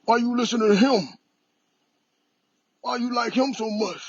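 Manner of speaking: mock the jealous elders; jealous parent. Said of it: "they pride".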